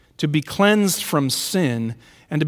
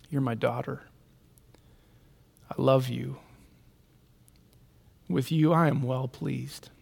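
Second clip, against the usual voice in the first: soft, gentle voice of a loving father; tender blessing; 4 semitones lower, 8 dB quieter than usual. Acted. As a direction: say it quietly, as a blessing. God's words spoken over the grieving.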